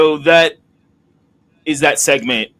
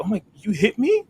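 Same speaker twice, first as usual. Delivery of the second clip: high, hurt mock disbelief; shocked betrayed wrestler; 11 semitones higher than usual. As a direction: play the wounded friend, pitched way up. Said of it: "He's like".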